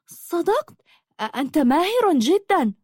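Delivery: high, astonished gasp of admiration; open-mouthed amazement. A narrator's reading. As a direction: marvel breathlessly, pitch jumping up. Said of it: "فغرت فاها وقالت". (بدهشة وانبهار، بصوت مرتفع الطبقة)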